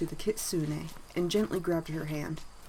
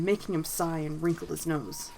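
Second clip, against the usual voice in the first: usual speaking voice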